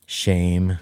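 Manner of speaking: ordinary talk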